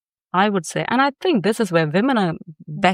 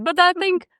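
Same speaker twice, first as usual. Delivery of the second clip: ordinary talk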